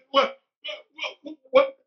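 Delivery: high, stammered mock bewilderment; panicked confusion. Retelling his panicked confusion.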